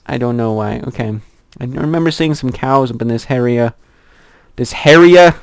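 ordinary talk

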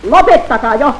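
1 fed-up call. Acted. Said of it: "kyllästyy Kaappo".

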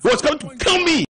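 high, loud panicked yell; a shout of alarm at the attacker; retold shout. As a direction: cry out as if your life is threatened. Retold fight over a cigarette.

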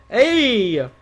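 loud, high hey; excited cheer. Real, not acted. Streamer greeting a shirt buyer, excited cheer.

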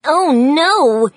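sudden loud cry of alarm; yelled. A narrator's reading. Pig yelling when the ball lands on the roof. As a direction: yell it out, caught off guard. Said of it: "Petey suddenly yelled".

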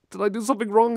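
high, anxious self-quote; mock worried. Retelling his worry to his partner, mock anxious. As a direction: pitch up in mock panic. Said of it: "And I was like".

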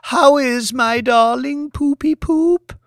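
high, slow, syrupy coo; cooing lady. A narrator's reading. Wife coos at her bedridden husband, high and slow. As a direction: coo at him like a pampered pet.

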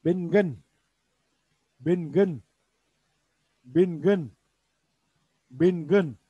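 Bingin said firmly, falling at the end; Urdu pronunciation. Shown as a demonstration. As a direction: model Bingin clearly. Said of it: "Patlıcan derken de bingin diyoruz". (Bingin kelimesini net ve düşen tonla söyle)

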